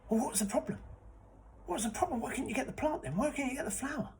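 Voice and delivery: high, pressing, impatient questions; eager questioning. Acted. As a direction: demand the answer eagerly. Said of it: "I said".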